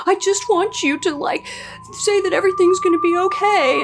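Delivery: high, whiny, hammed-up pleading; a high mock-tearful plea; mock whiny girlfriend. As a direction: mimic her teary begging, mockingly. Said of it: "she starts crying like".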